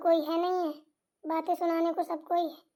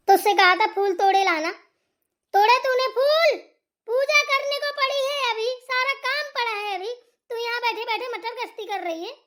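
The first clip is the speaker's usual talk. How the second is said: louder, higher angry yelling at the lazy bahu; angry scolding; 4 semitones higher, 3 dB louder than usual. Acted. Mother-in-law yelling at daughter-in-law about flowers, furious and loud. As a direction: shout the chores at her like a furious mother-in-law.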